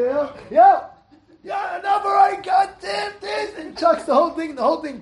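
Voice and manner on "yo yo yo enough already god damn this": high, strained mock yelling; angry father. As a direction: blow up at the family table. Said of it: "you ever see a guy getting angry".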